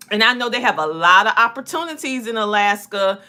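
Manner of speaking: normal talking voice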